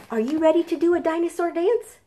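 high, fast eager question; excited invite. Pretend. Librarian inviting the children to dance, eager high question. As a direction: rev the kids up to dance.

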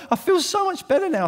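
high-pitched mock relief, imitating a churchgoer. Preacher imitating a churchgoer after a good cry, in a high mock-relieved voice. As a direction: chirp it up high like someone who just had a good cry.

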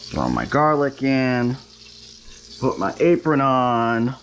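ordinary talk

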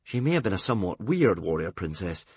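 usual speaking voice

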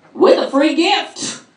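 hammed-up infomercial pitch; home shopping host. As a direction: sell it like a late night ad. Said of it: "late night infomercials".

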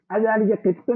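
"أذلك قط؟" asked high and bright; question rise. Shown as a demonstration. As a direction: ask "أذلك قط؟" as a clear question. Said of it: "ചോദ്യമായതുകൊണ്ട്". ("أذلك قط؟" ചോദ്യസ്വരത്തിൽ ഉയർത്തി)

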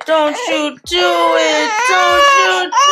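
high, loud, desperate pleading; rising frantic plea. Real, not acted. Pleading with crying baby.

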